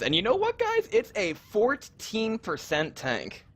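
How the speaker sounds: hyped, high-pitched reveal; excited. Real, not acted. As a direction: build it up like a big surprise. Caster reveals a surprising tank.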